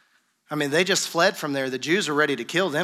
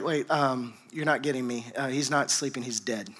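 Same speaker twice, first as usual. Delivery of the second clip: solemn hushed news; 6 semitones lower, 7 dB quieter than usual. Acted. Jesus tells disciples Lazarus died.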